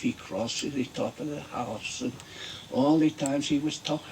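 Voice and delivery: put-on comic fairy tale in a Mexican accent. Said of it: "he had a, quite a Mexican accent".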